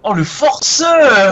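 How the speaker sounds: slow, high, loud teasing drawl; mocking drawl. Real, not acted. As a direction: stretch it out, mocking the forceur. Teasing the game master.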